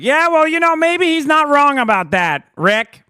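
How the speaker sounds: high, loud mocking impression of a pundit; mock Mark. Host mimics Mark turning on Rick, high and loud. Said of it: "three seconds away from being like".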